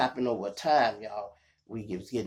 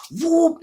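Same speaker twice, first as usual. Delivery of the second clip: high siren whoops; police siren; 10 dB louder than usual. Retold or imitated. Imitating the cop car behind him, high whoops. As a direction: mimic the siren again.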